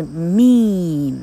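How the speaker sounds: meme with a long drawn-out "ee"; long vowel. Shown as a demonstration. Teacher showing learners that meme needs a long sound, long vowel in meme. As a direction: stretch the vowel of meme, the final e silent. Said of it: "它一定要长音".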